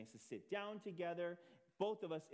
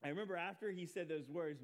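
ordinary talk, nothing marked

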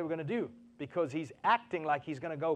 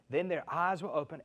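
ordinary talk, nothing marked

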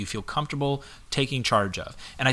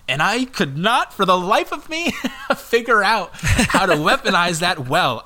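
high-pitched, loud disbelief breaking into laughter; worked-up, laughing exasperation; exasperated; 6 semitones higher, 5 dB louder than usual. Retold or imitated. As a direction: vent with amused frustration, voice pushed up high. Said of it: "I was getting so frustrated".

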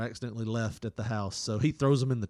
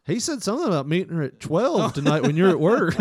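higher, louder tattling witness; hammed-up tattletale, pitched up; mock snitch; 7 semitones higher, 8 dB louder than usual. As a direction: rat him out gleefully.

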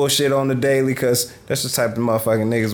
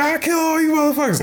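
mock yell. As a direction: yell it like a madman.

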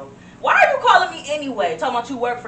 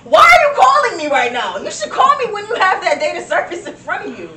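exasperated, louder scolding of the caller; annoyed agent; 6 semitones higher, 7 dB louder than usual. Acted. Call center agent scolding a caller, exasperated and loud. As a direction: scold the caller for wasting your time.

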